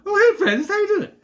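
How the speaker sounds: very high, loud goofy voice; Larry impression. Larry spotting Francis beside the TV, high exuberant greeting. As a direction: squeal an excited hello. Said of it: "he's talking to the kids".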